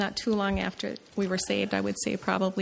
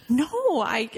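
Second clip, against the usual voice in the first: imitated tearful voice rising much higher; tearful reply. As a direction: retell the crying answer, voice breaking upward. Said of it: "And I'm crying".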